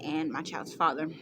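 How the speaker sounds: normal talking voice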